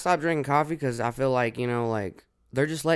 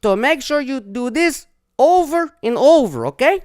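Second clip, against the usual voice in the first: mock German engineer; 12 semitones higher, 7 dB louder than usual. Host imitating the German engineer's instructions, German-accented. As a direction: drill the order like a strict German.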